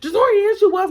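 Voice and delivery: high, loud contradiction; mama yelling. Retold or imitated. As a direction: imitate mom insisting angrily. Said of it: "she's down hard yelling at me".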